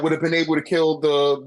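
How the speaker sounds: usual speaking voice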